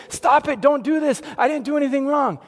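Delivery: high, loud, hurried pleading; mock frantic protest. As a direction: plead loudly as if falsely accused, hammed-up. Imagined panicked protest Jesus never made.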